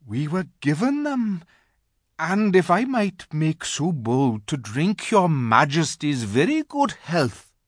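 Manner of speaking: a hint of a polite nervous fox; timid courtesy to a queen; lightly voiced old fox. A narrator's reading. Old fox humbly answering the witch queen. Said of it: "said the fox".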